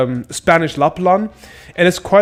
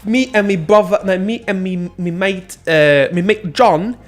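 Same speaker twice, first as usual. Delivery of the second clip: put-on higher-pitched Ali G-style London accent; hammed-up British roadman telling a story; mock British street accent; 5 semitones higher than usual. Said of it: "basically a joke on that accent".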